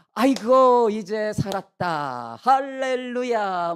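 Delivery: loud, drawn-out cry of relief; acted relief. Brothers safely heading home with Benjamin, hugely relieved cry. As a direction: let it out high and loud, stretching each exclamation. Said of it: "안도의 한숨을 쉬었을 것입니다".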